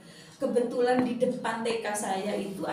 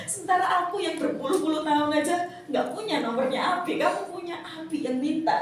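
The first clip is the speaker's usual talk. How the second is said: higher-pitched mock grievance; envious complaint; 6 semitones higher than usual. Envious friend comparing herself with the teacher, mock complaint. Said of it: "Umi itu iri".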